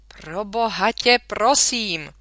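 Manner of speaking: a touch of exasperated yelling; mild outburst. A narrator's reading. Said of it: "rozkřikl se tatínek". (mírně naznačený výkřik)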